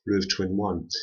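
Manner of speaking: ordinary delivery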